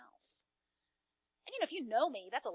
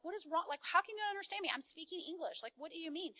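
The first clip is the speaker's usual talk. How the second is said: higher, quick, frustrated retelling; retold exasperation; 7 semitones higher, faster than usual. Wife arguing with husband who can't understand her, exasperated. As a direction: act out being exasperated at not getting through. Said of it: "I'm getting so angry".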